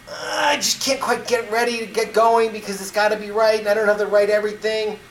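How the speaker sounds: fretful dithering. Retold or imitated. Acted hesitant inner voice.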